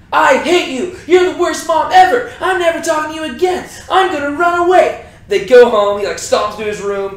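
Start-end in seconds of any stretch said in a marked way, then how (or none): 0.1-5.0 loud, high-pitched shouted tantrum; bratty child yelling at mom; toddler tantrum. Acted. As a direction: scream it like a kid denied a toy. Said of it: "sounds like a toddler who didn't get his toy".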